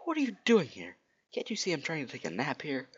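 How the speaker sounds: high-pitched indignant hedgehog; startled Sonic. Acted. Sonic woken from his nap by Rogue, high indignant voice. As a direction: squeal it in high, annoyed surprise.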